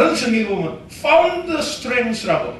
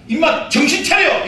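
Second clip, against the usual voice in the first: self rebuke; 6 semitones higher than usual. Retold or imitated. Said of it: "자기 스스로에게 말하는 것입니다".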